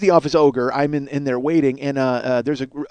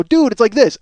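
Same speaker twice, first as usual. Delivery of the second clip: high, emphatic retold "dude"; insistent explaining; 6 semitones higher, 4 dB louder than usual. Teen showing friends what a word means, insistent. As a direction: pitch it up like an exasperated teen.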